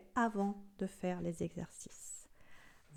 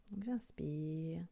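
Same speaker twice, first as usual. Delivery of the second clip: slow, soft breathing guidance; guided breathing; 5 dB quieter, slower than usual. Acted. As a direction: guide each breath softly and slowly.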